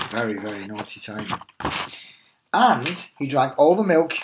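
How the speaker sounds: lively storybook read-aloud voice; animated teacher reading The Tiger That Came to Tea; reading to children. Acted. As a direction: tell it like a bedtime picture book for little ones. Teacher reads picture book aloud.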